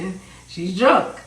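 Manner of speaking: put-on "drunk" stretched slow and loud; emphasis on drunk. Shown as a demonstration. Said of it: "put emphasis on the fact that she's drunk".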